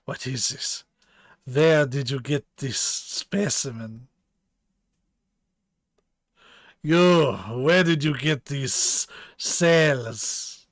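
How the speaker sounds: bad German accent for a stern scientist; lightly put-on German accent, questioning sharply; attempted German accent.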